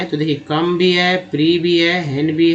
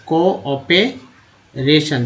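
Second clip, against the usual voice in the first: "Cooperation" very slowly, part by part; broken into parts; much slower than usual. Shown as a demonstration. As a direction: leave gaps between the parts of "cooperation". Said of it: "सीओ को बोलते हैं". ("Cooperation" को रुक-रुक कर, हिस्सों में)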